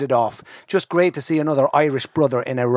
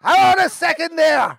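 calling out; 5 dB louder than usual. Retold or imitated. Calling across to workmates.

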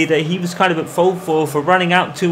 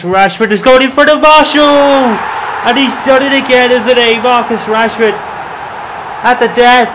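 big, high, loud goal yell, slowed; goal shout; 7 semitones higher, 7 dB louder than usual. Real, not acted. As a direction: roar it out as he scores. Late winning goal call.

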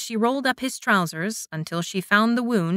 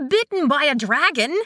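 higher alarmed disbelief; alarmed, repeat it back; shocked worried cry; cried; 6 semitones higher, 3 dB louder than usual. A narrator's reading. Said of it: "she cried".

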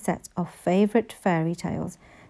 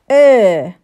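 a drawn, much louder er; loud hesitant er; 12 dB louder than usual. A narrator's reading. Henry stalling when asked to say thanks, loud.

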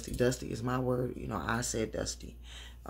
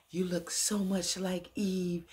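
slow, higher mock voice of a smitten man; lightly voiced man, slow and higher; mimicking Teddy; 6 semitones higher, slower than usual. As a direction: drawl it out like a man seeing a ghost. Mimics Teddy addressing Angela.

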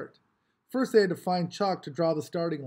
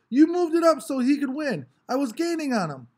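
much higher, loud accusing whine; angry protest; 9 semitones higher, 7 dB louder than usual. A narrator's reading. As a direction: blame the starter. Mars protesting.

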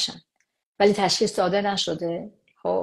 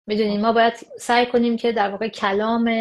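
ordinary delivery

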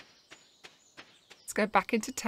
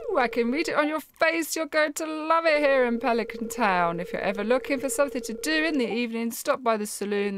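put-on higher, bubbly friendly young woman; cheerful waitress welcome, pitched up; bubbly barmaid; 4 semitones higher than usual. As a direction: gush warmly at the newcomer. Reads Emily's bubbly dialogue.

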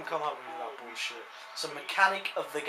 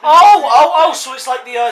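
excited; 8 semitones higher, 17 dB louder than usual. Real, not acted. Sudden realization.

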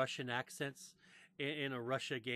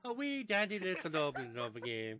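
mock Scottish; 2 semitones higher, 5 dB louder than usual. Riffing on the word wee.

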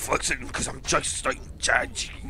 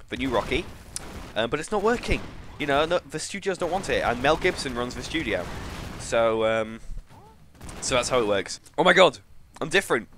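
ordinary delivery

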